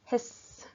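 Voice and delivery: "hiss" with a long sustained S at the end; drawn-out hiss sound. Shown as a demonstration. Teacher contrasts two sibilant sounds, sustained S in "hiss". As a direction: hold the final "ss" of "hiss". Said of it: "which is created with an S or a Z".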